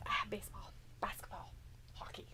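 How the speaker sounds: fading whisper down to nothing; whispered list. Real, not acted. Host whispers the other sports as a throwaway. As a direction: breathe the wrong answers out. Listing wrong answers under breath.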